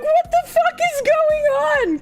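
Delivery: high-pitched, hammed-up incredulous cry; baffled outburst. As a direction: squeal it in mock disbelief. Retold bafflement at friend's remark.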